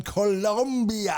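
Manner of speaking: slow, hammed-up Spanish accent on "Colombia"; pitching the guest a trip in a put-on Spanish accent; mock Spanish accent. Host luring a guest to the show, in a mock Spanish accent. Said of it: "That's my Spanish accent".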